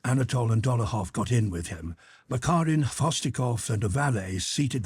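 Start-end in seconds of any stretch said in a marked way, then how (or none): none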